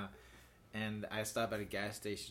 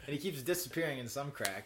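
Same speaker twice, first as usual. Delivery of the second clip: ordinary talk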